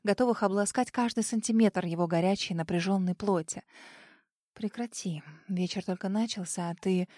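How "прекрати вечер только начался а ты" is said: breathless hushed whisper. Acted. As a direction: whisper it close. Flustered whisper while dancing.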